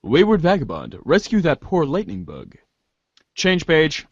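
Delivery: ordinary talk, nothing marked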